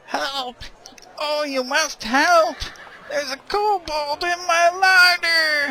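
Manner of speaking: put-on high, slow frantic old man cry; old man impression. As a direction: wail it like a panicked old villager. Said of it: "I apologize for my old man impression".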